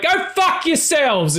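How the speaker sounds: high, slow drawn-out yell at the boats; hollered curse from the cliffs; high, drawn-out mock yell at the ships; mock convict yell. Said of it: "He would run out and be like".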